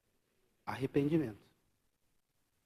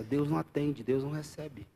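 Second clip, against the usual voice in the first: ordinary talk, nothing marked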